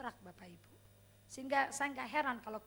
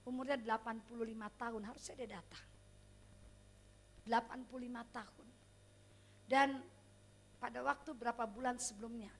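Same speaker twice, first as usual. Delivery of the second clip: ordinary talk, nothing marked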